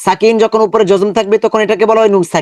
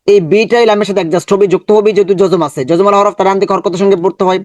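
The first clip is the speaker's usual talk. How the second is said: usual speaking voice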